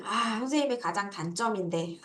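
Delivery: teacher joking about being short; rueful aside. Real, not acted. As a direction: mutter it sheepishly. Rueful aside about own height.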